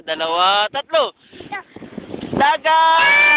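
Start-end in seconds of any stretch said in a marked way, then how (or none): 2.4-3.4 long, held call of "dagat"; shouted demonstration. Shown as a demonstration. Parent counts child in, long call-out. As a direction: call it out and stretch it. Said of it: "Sigaw mo, dagat!".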